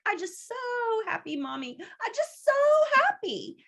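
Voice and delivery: put-on high, sing-song child's voice; little girl voice. Toddler tells her mother she is so happy, high and sing-song. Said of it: "And she's like".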